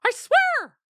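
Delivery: high, loud howled oath; a dog's high, loud protest; howling dog. Acted. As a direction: swear it in a high wail. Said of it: "Pearl howled".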